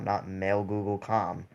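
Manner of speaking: usual speaking voice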